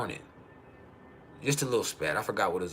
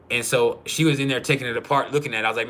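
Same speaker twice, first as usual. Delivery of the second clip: ordinary talk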